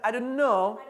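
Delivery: I don't know rushed and run together; fast slurred. Shown as a demonstration. Teacher showing how native speakers say I don't know. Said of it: "कोई इतना fast बोलता है".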